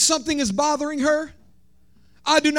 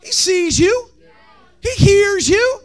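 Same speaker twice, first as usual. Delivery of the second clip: raised, ringing sermon cry; high preaching climax; 7 semitones higher than usual. Acted. Pastor preaching to the congregation, high ringing cry. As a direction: lift it up and hold it.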